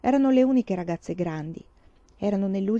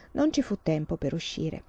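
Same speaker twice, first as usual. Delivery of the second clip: plain reading voice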